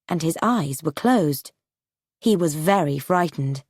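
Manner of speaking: usual speaking voice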